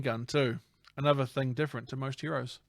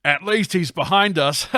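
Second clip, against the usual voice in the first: loud, higher acted voice; hammed-up, raised reassurance; villain boss; 4 semitones higher, 8 dB louder than usual. Boss to henchman fleeing in the truck, loud.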